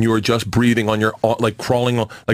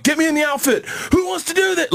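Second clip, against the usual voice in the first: much higher, cocky retold bravado; hammed-up false confidence; mock bravado. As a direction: swagger it like a fake hero.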